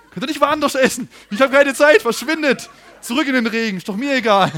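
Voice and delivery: loud, high exasperated outburst; mock angry rant. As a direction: shoo the kids away in fake fury. Imagined rude rant at boys.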